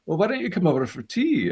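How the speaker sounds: high-pitched imitation of a Scottish actor; Peter Capaldi. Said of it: "Peter phoned me and said".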